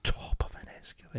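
hushed unvoiced whisper. Real, not acted. Friend whispers the phrase in mock outrage. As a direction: breathe it in disbelief.

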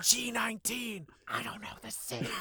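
soft unvoiced stage whisper; whisper shout. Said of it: "that whisper shout".

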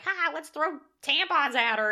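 high, loud mock-bully taunt; mocking jeer. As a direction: jeer like a gleeful crowd of bullies. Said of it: "it's, like, made fun of".

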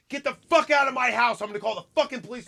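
high-pitched imitated scream, held back in volume; restrained mock shriek. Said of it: "Fucking screaming this all into the neighborhood".

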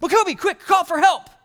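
high, loud pretend panic; mock urgency. Pastor urging the pretend-dead boy, high loud mock panic. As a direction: cry out to him in mock panic.

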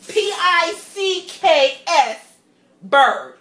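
"P I C K S, Burgh" spelt slowly and loud, letter by letter; spelling it out. Shown as a demonstration. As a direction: spell out how he mangled "Pittsburgh", slow and loud. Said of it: "the way he said Pittsburgh".

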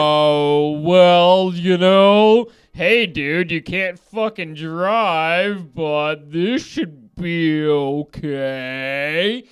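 very slow, stretched-out syllables; exaggerated slow, spaced-out drawl; stoned slow-motion talk. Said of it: "That's probably how I said it to him".

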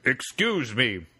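slow, drawn-out hammed-up chief voice; mock Native chief. Prank caller asks the researcher for help, slow mock chief. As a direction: drag every word like a cartoon chief. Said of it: "tell him it's heap big skunk ape".

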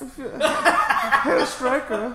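high, loud, very slow laughing German voice; mock German accent. Friend addresses Stryker in mock German, laughing. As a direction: drag out Herr Stryker in fake German.

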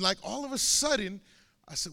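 normal talking voice

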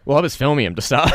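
normal talking voice

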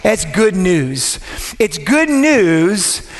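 slow, stretched-out hype on good news; milk the good news line; drawn out. Acted. Preacher hyping the congregation, slow and drawn out.

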